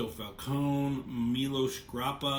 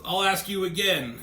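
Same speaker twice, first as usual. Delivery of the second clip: insistent demand; 6 semitones higher, 7 dB louder than usual. Retold or imitated. Dent presses the witness.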